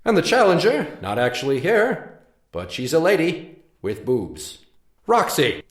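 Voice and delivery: loud ring announcer call; grand entrance announcement. Acted. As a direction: announce the challenger to the crowd.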